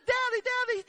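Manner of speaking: put-on high, soft child's plea; imitating crying son. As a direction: plead like a scared little boy. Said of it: "as he's crying, he's saying".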